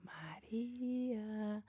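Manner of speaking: very slow, drawn-out unvoiced whisper. A ghost whispering the girl's name at her window. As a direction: stretch the name out like a ghost calling. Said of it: "susurraban su nombre".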